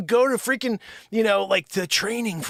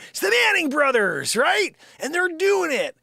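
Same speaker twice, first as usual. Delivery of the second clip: higher, louder hyped fan; higher, louder Giants fan hype; excited shout; 8 semitones higher, 5 dB louder than usual. Real, not acted. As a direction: cheer it like your team is winning. Hyped about Giants quarterback.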